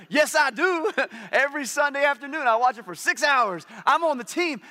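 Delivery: loud, high, outraged sports fan; mock indignant shout. Fan insisting to a non-fan, loud mock outrage. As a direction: yell it like a fan who feels on the team.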